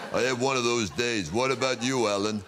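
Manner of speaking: thick-tongued American tough guy; Sylvester Stallone. Acted. Comic as action star asking his co-star Alan, tough-guy American voice. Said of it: "I used to do Sylvester Stallone".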